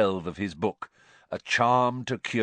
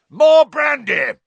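very high, loud yell; 14 dB louder, slower than usual. A narrator's reading. Uncle yelling for brandy, very loud. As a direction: shout it in a panic. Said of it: "yelled Uncle Vernon".

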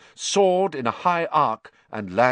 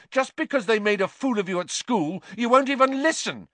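yelling; 12 semitones higher, 3 dB louder, faster than usual. Acted. Harry yelling on.

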